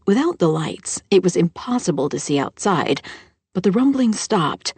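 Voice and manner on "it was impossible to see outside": ordinary delivery, nothing marked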